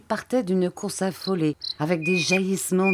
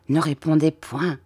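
ordinary delivery, nothing marked

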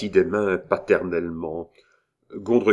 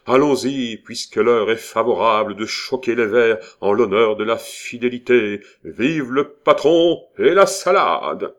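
rousing toast; 3 semitones higher, 8 dB louder than usual. A narrator's reading. Militaire closes his speech toasting the boss, rousing.

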